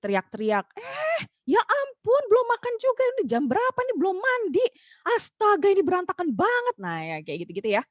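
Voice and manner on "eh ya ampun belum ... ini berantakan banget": high, shrill-free mock scolding mom voice, much higher; nagging mom. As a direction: play the frazzled mom scolding her kid. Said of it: "emak-emak ini kan teriak-teriak". (suara emak-emak mengomel, jauh lebih tinggi)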